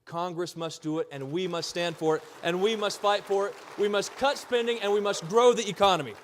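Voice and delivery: building from firm to loud; escalating comedy rant. Real, not acted. Rally crowd, rising chant.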